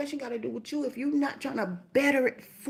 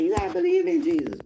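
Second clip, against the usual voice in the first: higher, louder, fast mock insistence; pushy believers; 7 semitones higher, 7 dB louder, faster than usual. Imitating people who push Jesus on her, mock insistence. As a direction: mimic pushy people preaching. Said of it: "People like".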